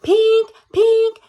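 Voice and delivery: high, loud beeping alarm. A narrator's reading. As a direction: ring it out like an alarm clock. Alarm clock sound imitated.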